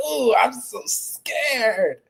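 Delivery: high, slow, sarcastic "ooh"; zesty mock fear. Streamer imitating Floyd's taunt from the clip, zesty mock fear. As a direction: drag out the pretend fright. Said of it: "Kinda zesty with Floyd part".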